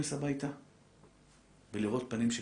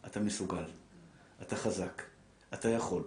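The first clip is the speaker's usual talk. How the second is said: encouraging; 6 semitones lower, 7 dB quieter than usual. Shown as a demonstration. Encouraging words to husband.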